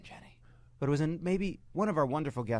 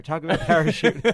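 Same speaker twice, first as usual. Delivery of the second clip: loud laughing plea; 5 semitones higher, 11 dB louder than usual. Real, not acted. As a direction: laugh while pushing the sponsor. Laughing through the ad.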